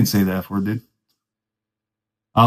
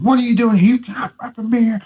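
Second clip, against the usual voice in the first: retold yell; 10 semitones higher than usual. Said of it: "interrogating us at the top of his lungs".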